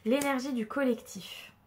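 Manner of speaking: ordinary talk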